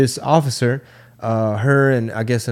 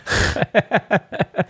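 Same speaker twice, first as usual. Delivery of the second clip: airy laugh, pitched up; unvoiced high giggle; a real, breathless little laugh; breathy high laugh; 9 semitones higher than usual. Real, not acted. Laughs at own Google search.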